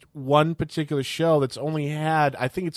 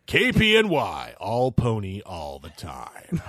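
radio announcer voice; 7 semitones lower, 4 dB quieter, slower than usual. Pretend. Mock radio slogan.

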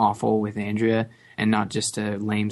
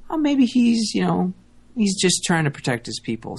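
mock naive musing; 8 semitones higher, 3 dB louder than usual. Voicing the viewer's softened view.

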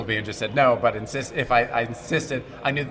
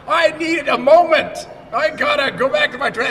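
mock diva fit; 13 semitones higher, 5 dB louder than usual. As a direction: ham up a fussy star demanding a break. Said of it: "I can throw a fit".

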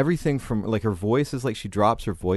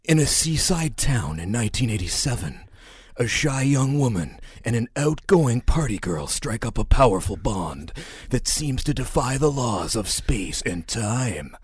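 slow, dramatic voiceover announcer; movie trailer announcer; 4 semitones higher than usual. Shown as a demonstration. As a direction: epic trailer narration, drawn out. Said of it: "do it again for me. In the voice".

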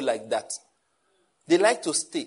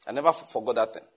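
normal talking voice

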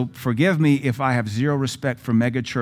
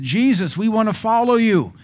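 high, louder eager plea; eager followers; 9 semitones higher, 4 dB louder than usual. Retold or imitated. Preacher voicing eager would-be followers, high and loud. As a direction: play the keen followers begging to come along.

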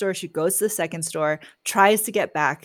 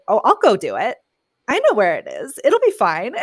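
breezy confidence; 7 dB louder than usual. Retold or imitated. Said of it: "and she's like".

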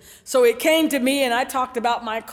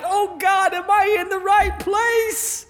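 drawn-out worried plea, slightly raised; lightly acted dismay; mock panic; 8 semitones higher, slower than usual. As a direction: wonder anxiously, dragging out the end. Panics at the lady's challenge.

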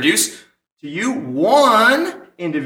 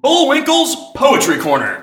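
higher and louder, announced big; grand title; 7 semitones higher, 4 dB louder than usual. Acted. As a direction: hype the segment title.

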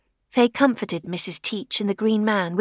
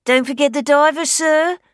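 higher, louder music-hall comic line; radio catchphrase voice; 6 semitones higher, 6 dB louder than usual. Acted. Scarecrow mimicking the radio, in a comic voice. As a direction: pop it out like an old wireless gag.

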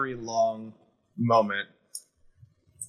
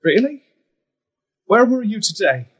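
much higher, louder bitter accusation; angry retort; 10 semitones higher, 6 dB louder than usual. Acted. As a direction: throw it back at her, furious. Son confronts absent fairy queen.

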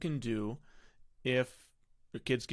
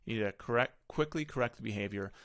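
usual speaking voice

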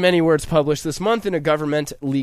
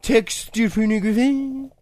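mock French accent; 7 semitones higher than usual. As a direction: say it like a snooty Frenchman, stretched out. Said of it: "They say it in their weird French accent".

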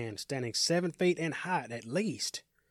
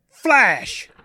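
loud, high, sharp shout; called command; 9 semitones higher, 14 dB louder than usual. Acted. Dwayne tests whether the ring will fire, shouting the command. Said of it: "A command is called by Dwayne".

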